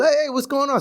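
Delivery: high, breezy hello; imitating a chummy cop's hail; returned friendly greeting. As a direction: greet him like an old colleague. Retold cop greeting him back.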